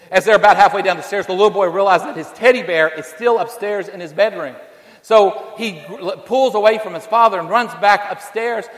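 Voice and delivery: loud, fast and rising story preaching; preaching at full force. Acted. Preacher tells the fire story to the congregation, loud and urgent. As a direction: drive the fire story hard, voice climbing.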